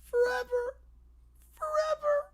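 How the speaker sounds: forever sung out high and soft, drifting; high echoing forever. Acted. Kids stuck at the airport wail forever, high and soft. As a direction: let forever float up high and thin like an echo. Echoing cry of being trapped.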